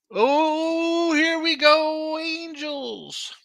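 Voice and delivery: high, stretched-out announcer cry; mock excitement. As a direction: ham up the hype for a team you doubt. Mock-dramatic intro of the Angels.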